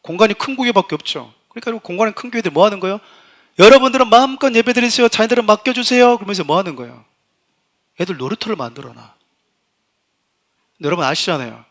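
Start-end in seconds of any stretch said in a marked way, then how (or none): 3.6-6.2 높고 크게 권유하는 흉내; 회중에게 외쳐 안내하는 듯한 과장. Retold or imitated. 큰 교회의 권유를 흉내. (큰 소리로 호객하듯 흉내)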